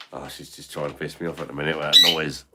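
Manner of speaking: ordinary talk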